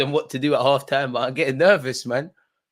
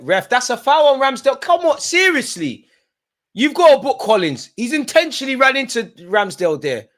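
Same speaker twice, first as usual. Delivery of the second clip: higher and louder, demanding the ref act; angry appeal; 9 semitones higher, 5 dB louder than usual. Real, not acted. Fan demanding the ref book Collins, angry shouting. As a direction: shout the foul claim in outrage.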